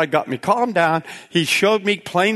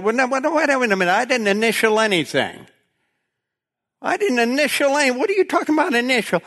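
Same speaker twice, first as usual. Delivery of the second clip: incredulous protest; 7 semitones higher than usual. Retold or imitated. Caller protesting the charge to the billing lady, incredulous and higher. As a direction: voice jumps way higher, stunned and indignant.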